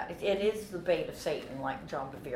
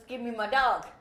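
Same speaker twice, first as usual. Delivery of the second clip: slightly raised, restrained demand; subdued demand; 4 semitones higher than usual. Retold or imitated. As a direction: ask for it back without shouting. Owner repeats demand, more subdued.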